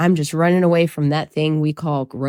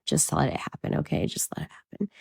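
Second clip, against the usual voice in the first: whispered aside; 10 dB quieter, faster than usual. Real, not acted. Telling viewers to let her accents happen, whispered. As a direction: drop to a soft whisper. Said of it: "whispered aside about accents".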